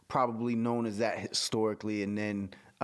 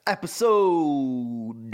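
announcer voice; 6 dB louder, much slower than usual. Pretend. Drawn-out announcer word.

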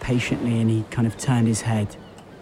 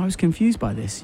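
usual speaking voice

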